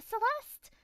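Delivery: very high, loud squeal of a name; trial character voice. Shown as a demonstration. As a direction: call it out in a sudden high, loud pitch. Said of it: "gonna try all different voices".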